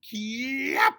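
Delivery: sudden high, loud kiai yell; scream. Acted. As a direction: let the kick burst out as a yell. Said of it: "somebody screams".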